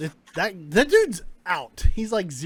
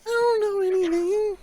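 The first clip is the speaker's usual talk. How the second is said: high-pitched, incredulous protest; bewildered denial; 12 semitones higher, 3 dB louder than usual. Acted. Captive denying he knows anything of the stone, bewildered. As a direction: pitch it way up, baffled and defensive.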